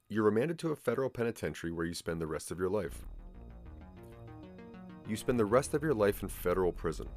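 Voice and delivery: ordinary talk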